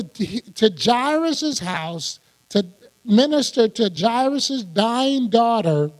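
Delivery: normal talking voice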